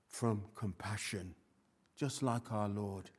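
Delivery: ordinary delivery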